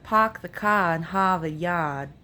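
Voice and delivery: "Park the car in Harvard Yard" as "Pahk the cah in Hahvahd Yahd", no R; r-dropping Boston accent. Shown as a demonstration. Vlogger comparing a Boston accent to Appalachian. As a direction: drop every R, Boston style, with broad "ah" vowels. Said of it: "the Brooklyn accent says the A R sound".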